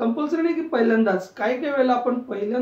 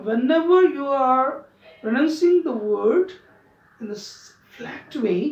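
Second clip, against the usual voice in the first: very slow; much slower than usual. Shown as a demonstration. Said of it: "teacher slowing English".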